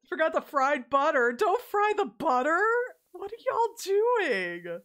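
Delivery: loud, high-pitched comic disbelief; mock outrage. Protesting at Midwesterners frying butter, mock outrage.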